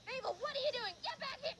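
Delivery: high-pitched scolding from far away; mock yell of a cross woman; lady yelling. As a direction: holler high across a lake. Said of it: "some lady was yelling".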